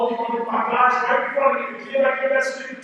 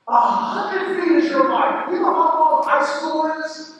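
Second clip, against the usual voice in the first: louder, driving assembly preaching; a speaker firing up a gym full of teenagers; fired-up motivational speech; 2 semitones higher, 5 dB louder than usual. Acted. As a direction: pound every point home to the students. Speaker rallies the school assembly.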